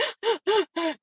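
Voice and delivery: imitated breathless sobbing gasps; high, catching gasps as if sobbing; ugly-cry gasps. Shown as a demonstration. Showing listeners what an ugly cry is, imitated sobbing gasps. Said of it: "get your breaths together, like".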